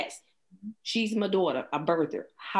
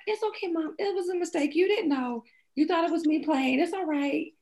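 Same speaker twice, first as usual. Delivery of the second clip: put-on much higher, sweet small-child voice; imitating her daughter; 11 semitones higher than usual. Mother voicing her daughter forgiving her, high childlike. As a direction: play a gentle six-year-old comforting mom. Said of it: "She's like".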